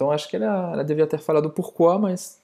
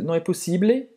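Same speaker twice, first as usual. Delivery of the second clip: ordinary delivery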